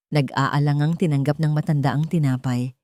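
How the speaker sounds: ordinary delivery, nothing marked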